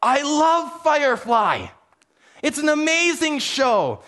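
light up with delighted enthusiasm; imitating a thrilled fellow fan; excited fan. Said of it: "their eyes just lit up".

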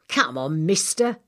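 impatient workman. Acted. Stretcher man to Hawksbill, impatient. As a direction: hurry him along.

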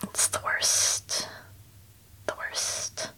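soft unvoiced crying whisper; real tearful whisper; whispering through tears. Real, not acted. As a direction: barely get it out while crying. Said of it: "now I can't".

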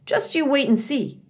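a hint of a confident beaver; lightly voiced beaver. A narrator's reading. Mister Beaver voiced high.

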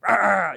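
short loud mock growl. Pastor joking that God would growl at the faithful, a loud mock growl. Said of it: "then He's like".

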